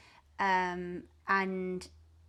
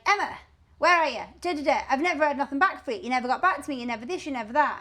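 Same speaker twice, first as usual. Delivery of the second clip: put-on high, louder Scottish-accented telling-off; broad Scottish; 5 semitones higher, 5 dB louder, faster than usual. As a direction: imitate a Scot rattling off complaints. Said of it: "broad Scottish, love him".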